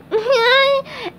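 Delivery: high cartoon giggle; silly sponge giggle. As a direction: giggle happily through tears. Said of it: "SpongeBob giggled".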